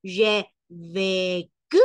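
"J'ai vécu" drawn out syllable by syllable, rising; slow accented vécu. Shown as a demonstration. Teacher explaining the accented E, slow accented vécu. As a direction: stretch "J'ai vécu", full é in each place. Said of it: "porque sí va tildada la E".

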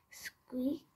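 ordinary delivery

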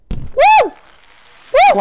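high, loud whooping cheer; wild gamer whoop; excited whoop. Real, not acted. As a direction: let out a big whoop of joy. Cheering mid game.

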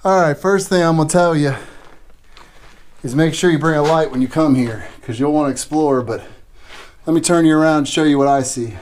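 normal talking voice

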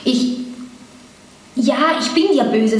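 halting admission after a long pause; start, stop, then admit it tentatively; hesitant. A narrator's reading. Man admits to the woman he is cross, hesitant. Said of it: "sagte er zögerlich".

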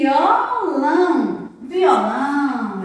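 Violão drawn out very slowly; slow, clear Violão for kids; slow teaching word. Shown as a demonstration. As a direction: stretch Violão out for the children.

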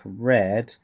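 usual speaking voice

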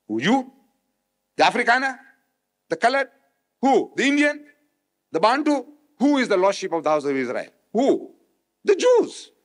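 mounting rhetorical challenge, the last word leaping up high; rising rhetorical questions. Real, not acted. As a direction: quiz the crowd, building to the answer. Rhetorical quiz of the audience.

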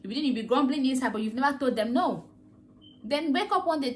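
ordinary delivery

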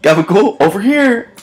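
mock hawker. Host replays the comment as a playful call. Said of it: "Seven thumbs up".

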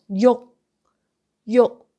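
"yo" short and loud, halted before the K escapes; stopped K. Shown as a demonstration. Teacher shows where to stop, "yo" with silent K. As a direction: punch "yo" and stop dead, no puff. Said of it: "You stop there".

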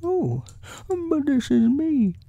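very high, surprised bear voice. A narrator's reading. Bear learning he is being visited, high surprised voice. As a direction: exclaim high as a baffled bear. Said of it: "very much surprised".

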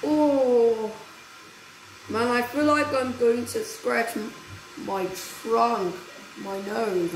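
ordinary talk

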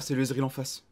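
usual speaking voice

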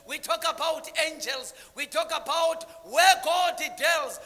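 loud, high fervent sermon; preacher in full flow; full-force preaching. Real, not acted. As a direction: drive the story home to the crowd.

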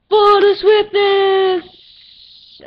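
high, loud, long drawn-out shout; battle cry. Pretend. Gamer downing a swiftness potion, shouted battle cry. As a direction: bellow it like a charge.

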